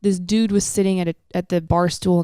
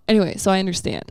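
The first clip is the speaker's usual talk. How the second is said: usual speaking voice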